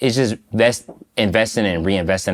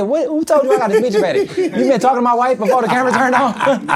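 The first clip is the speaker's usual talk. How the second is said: much higher, laughing through a teasing comeback; laughing mock outrage; 3 dB louder than usual. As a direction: play shocked while cracking up. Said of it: "That's why I said".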